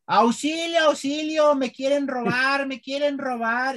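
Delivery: high, slow mock cries for help; mock screaming child. Child screaming for help at his mother in the street, high mock yell. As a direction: yell like a kid faking a kidnapping. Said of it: "me puse a gritar como loco".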